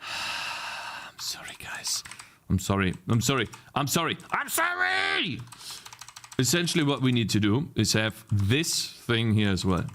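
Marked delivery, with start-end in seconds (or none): 0.0-2.0 slow, hushed whisper; whispered apology. Real, not acted. Streamer apologizing to viewers after swearing, slow whisper. 4.3-5.4 high, drawn-out mock-desperate cry; yelled apology. Real, not acted. Streamer apologizing to viewers, escalating to a high drawn-out yell.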